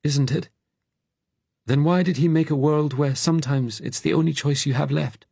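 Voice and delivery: high, bitter challenge; high-pitched, anguished protest; Frank. A narrator's reading. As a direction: argue back in a high, upset voice.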